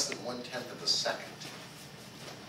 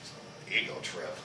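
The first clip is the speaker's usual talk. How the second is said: quiet dismissal; 8 semitones lower, 6 dB quieter than usual. Retold or imitated. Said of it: "they're gonna go".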